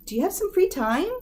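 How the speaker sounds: "free" lifted high in a bright rising question; friendly asking. Shown as a demonstration. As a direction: ask "free time" with a high, hopeful lift. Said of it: "you might be able to ask somebody".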